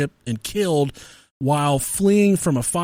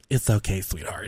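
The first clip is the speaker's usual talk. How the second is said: soft, lower, gentle coo; mock tender; 8 semitones lower, 7 dB quieter than usual. Drunk man to embarrassed boy, mock tender and soft. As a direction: imitate a big man suddenly going sweet and soft. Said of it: "And he didn't talk like that".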